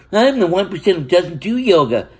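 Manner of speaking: high, sing-song mockery of a smug boast; mocking smug voice. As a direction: parody the self-satisfied show-off. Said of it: "I don't go".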